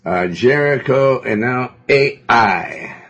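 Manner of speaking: loud, high, slow proclamation; emphatic preaching. Real, not acted. Preacher echoing the reader's verse to the congregation, loud and emphatic.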